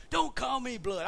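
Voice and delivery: retold irritation, pushed up and forceful; annoyed protest. Retold quarrel over the nickname.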